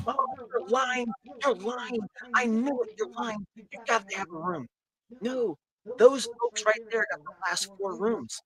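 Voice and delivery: high-pitched, worked-up protest; acted indignant accusation. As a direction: accuse them of lying, voice pitched right up. Acted angry half-orc arguing with bartender.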